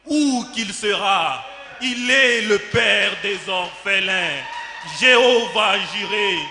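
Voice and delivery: loud, emphatic preaching declamation; fervent proclamation. Acted. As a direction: proclaim it forcefully to the congregation. Proclaims God as orphans' father. (proclamation fervente, d'une voix forte)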